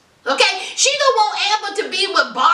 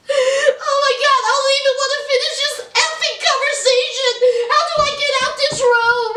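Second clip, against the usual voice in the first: higher, hammed-up hysterical outburst; mock dramatic meltdown; 6 semitones higher than usual. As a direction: play the jilted girl in a theatrical panic, climbing higher. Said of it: "But she was very dramatic".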